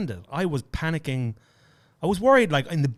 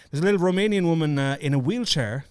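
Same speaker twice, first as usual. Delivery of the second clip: ordinary talk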